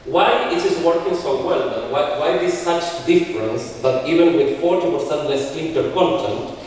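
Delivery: ordinary talk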